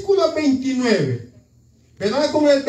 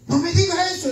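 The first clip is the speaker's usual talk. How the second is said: king dismayed; 7 semitones higher, faster than usual. Acted. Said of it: "dijo el rey".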